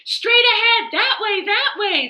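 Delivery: high, loud and pointing; eager shout. Acted. Frogs shouting directions to the chicken, high and loud. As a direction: call out the way eagerly. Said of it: "the frogs all shouted".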